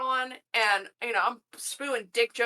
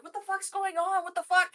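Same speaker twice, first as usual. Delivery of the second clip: shocked coworkers; 8 semitones higher than usual. Retold or imitated. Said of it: "everybody was like".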